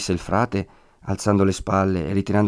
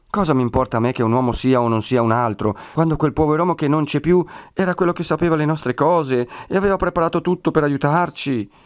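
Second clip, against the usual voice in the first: plaintive protest climbing higher; grateful impatience; 6 semitones higher than usual. A narrator's reading. Woman wanting only the absent friar, impatient protest. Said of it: "misto di gratitudine e di impazienza".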